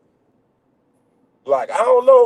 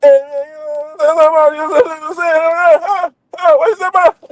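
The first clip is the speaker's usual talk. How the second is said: high-pitched mock mumbler with a Southern dialect; imitating garbled partner; 9 semitones higher than usual. Man imitating his country partner for laughs, garbled high voice. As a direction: mimic a friend's hard-to-follow country talk, pitched up.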